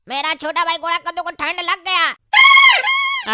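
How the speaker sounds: loud, slow taunting shout; drawn-out mocking yell. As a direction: yell it out slowly to the street. Mocking shout about little brother.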